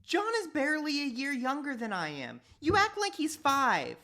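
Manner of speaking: high, loud exasperated retort; boy arguing with mom, high and indignant. Acted. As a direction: argue the obvious point.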